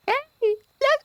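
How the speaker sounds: very high falsetto fairy call; Navi. Acted. Streamer retrying the game helper's voice for chat, high-pitched. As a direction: squeak it out like a tiny pestering fairy. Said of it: "hear that in your head in the Navi voice".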